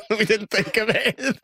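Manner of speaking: high, real laughter through the words; laughing. Real, not acted. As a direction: crack up while admitting it. Laughs at having had no plan.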